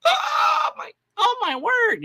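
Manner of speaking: very high-pitched imitation of shocked outrage; mock flipping out. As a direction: squeal it like someone melodramatically scandalized. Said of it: "they flip out, they lose it".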